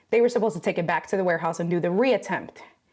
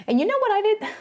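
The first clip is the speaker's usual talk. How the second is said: high squeaky tease; 12 semitones higher, faster than usual. Acted. Streamer teasing her chat in a high squeaky voice. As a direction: jump way up high into a squeaky put-on voice.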